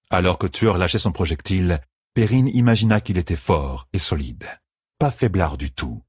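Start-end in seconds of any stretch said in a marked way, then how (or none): none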